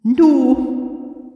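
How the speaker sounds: put-on high, prim old librarian; clipped, fussy old lady librarian. A narrator's reading. Deirdre voiced.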